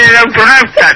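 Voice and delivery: ordinary talk, nothing marked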